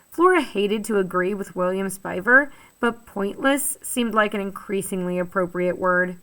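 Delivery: plain reading voice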